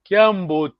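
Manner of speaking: Kiambote dit clairement, ton marqué, plus fort; Kiambote prononcé avec soin. Shown as a demonstration. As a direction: articule Kiambote pour les élèves. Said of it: "écoutez-moi bien". (Kiambote articulé lentement pour la classe)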